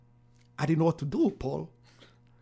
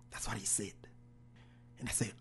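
normal talking voice

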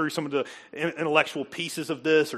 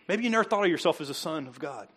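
normal talking voice

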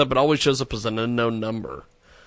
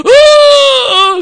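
very high, loud mocking squeal; mock shriek; 14 dB louder than usual. Imitating the freaked out woman, high loud squeal. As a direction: squeal it out in fake alarm. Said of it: "she's probably like".

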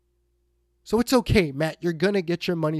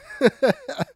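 short high-pitched laugh; real burst of laughter, higher than the talking voice; knowing laugh; 8 semitones higher, 4 dB louder than usual. Real, not acted. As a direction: laugh at what is coming. Scoffs at fans' coming overreaction.

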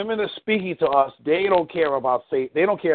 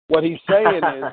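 ordinary talk

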